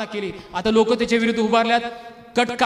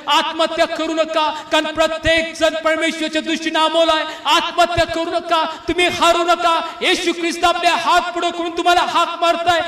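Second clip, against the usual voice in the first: full-force preaching; 5 semitones higher than usual. Real, not acted. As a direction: preach it out, pleading with them. Pleading with the desperate to live.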